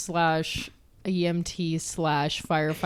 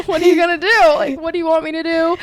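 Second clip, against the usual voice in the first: much higher and louder, frantic and rushing; panicked; 10 semitones higher, 11 dB louder, much faster than usual. Retold or imitated. Friend re-enacting her panic at the swollen face, frantic. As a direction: blurt it out in a flustered panic. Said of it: "That's exactly what you said".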